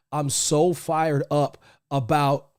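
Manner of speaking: ordinary talk, nothing marked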